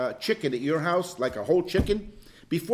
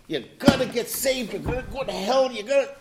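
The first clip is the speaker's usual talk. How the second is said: mock pushy preacher; 6 semitones higher than usual.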